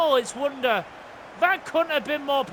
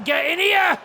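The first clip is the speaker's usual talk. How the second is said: rising to a high, loud shout on the name; building excitement; 4 semitones higher, 5 dB louder than usual. Real, not acted. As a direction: commentate an attack as it builds to a shot. Attack building to a shot.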